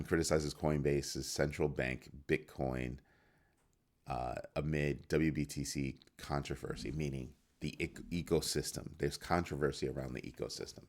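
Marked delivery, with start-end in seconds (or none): none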